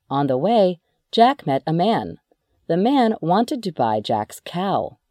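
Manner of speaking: ordinary delivery, nothing marked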